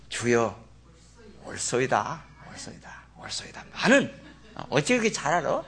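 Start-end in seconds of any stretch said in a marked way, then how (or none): 0.1-3.6 softer and softer to a whisper; humble fading reply. Acted. Mother humbly answering Jesus, fading to a whisper. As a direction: let each yes sink lower until whispered.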